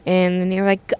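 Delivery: ordinary talk, nothing marked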